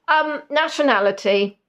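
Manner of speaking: ordinary talk, nothing marked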